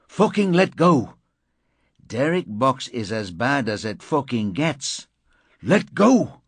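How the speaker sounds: high, loud, struggling shout; furious yell. Acted. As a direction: yell to break free. Struggling, yelling to be released.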